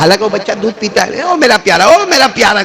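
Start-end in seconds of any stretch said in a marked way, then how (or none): 1.2-2.6 high, swooping imitation of a doting mother; mother calling. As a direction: call out to your darling baby from afar. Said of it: "ماں دور سے ایک آواز دی". (دور سے پکارتی ماں کی نقل)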